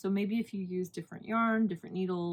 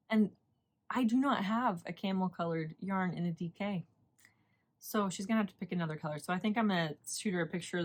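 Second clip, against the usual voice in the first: ordinary delivery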